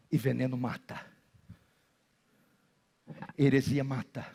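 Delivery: very low, soft sermon voice; quiet, measured warning; hushed solemn preaching. Real, not acted. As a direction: warn them gravely, pausing between phrases.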